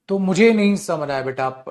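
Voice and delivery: ordinary talk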